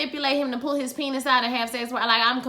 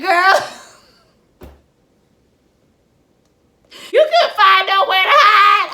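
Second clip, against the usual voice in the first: high and loud, words broken up by real laughter; cracking up so hard the words barely get out; laughing hard; 9 semitones higher, 11 dB louder than usual. Real, not acted. Host cracking up at a character hiding on the floor, laughing. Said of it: "That tickled me".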